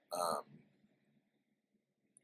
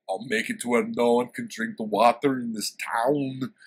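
louder, declaring mock-heroic threat; big loud vow; boastful hero voice; 3 semitones higher, 7 dB louder than usual. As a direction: proclaim it like a barbarian hero. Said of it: "So he's like".

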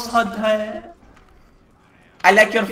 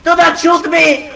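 loud, high begging shout; panicked shout; 6 semitones higher, 9 dB louder than usual. Acted. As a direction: yell it, begging not to be shot. Panicked in-game plea.

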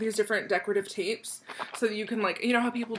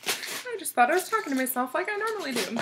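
ordinary talk, nothing marked

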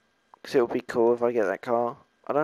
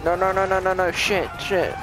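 high, panicked string of no's; terrified horror victim; 6 semitones higher than usual. Real, not acted. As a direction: panic rising as it all goes wrong. Caught by police in game.

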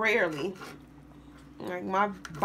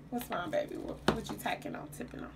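hushed, tender aside to a little one; 9 dB quieter, faster than usual. Real, not acted. Mother speaking softly to her baby mid-recipe, hushed. Talks softly to a child off camera.

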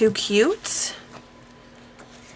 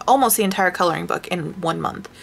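usual speaking voice

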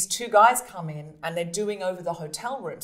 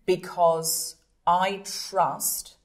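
usual speaking voice

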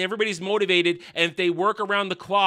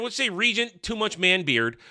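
normal talking voice

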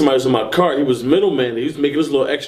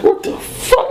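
very high, breathy outburst; horrified quote, voice leaping up; shocked retold reaction; slower than usual. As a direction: exclaim in high-pitched disbelief. Sees the bullet holes.

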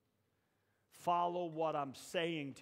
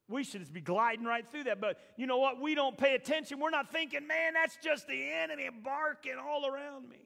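higher and louder, pushing the crowd; preaching at full force; 11 semitones higher, 9 dB louder than usual. Acted. Preacher rousing the congregation, full force. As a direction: preach it, rising.

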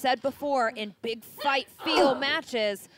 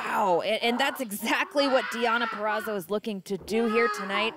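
ordinary talk

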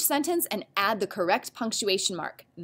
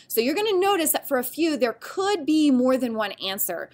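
ordinary talk, nothing marked

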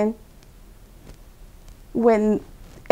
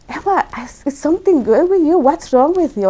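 higher-pitched imitation of a baffled husband; retold baffled alarm; 7 semitones higher than usual. Husband questioning his wife's sudden conversion, in retold baffled alarm. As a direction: act out his disbelief, pitched up.

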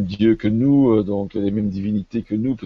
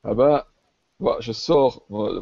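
usual speaking voice

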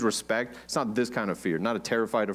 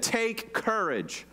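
slow, high, soft take courage; Jesus calming his frightened disciples; slow reassurance; 8 semitones higher, 4 dB quieter, much slower than usual. Acted. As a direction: lay each word down gently. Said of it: "He said".

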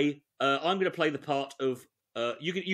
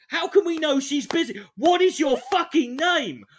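much higher, loud exasperated shout; 11 semitones higher, 6 dB louder than usual. Real, not acted. Comedian ranting at the script, exasperated and loud. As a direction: blow up in disbelief. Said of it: "exasperated outburst".

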